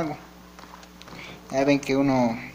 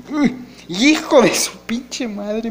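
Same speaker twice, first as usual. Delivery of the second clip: outraged curse; 8 semitones higher, 5 dB louder, much slower than usual. Real, not acted. Gets kicked in the game.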